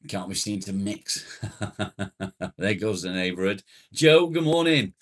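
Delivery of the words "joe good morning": high, loud hello; calling out greeting. Real, not acted. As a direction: shout a friendly hail across to them. Hailing a viewer joining the stream.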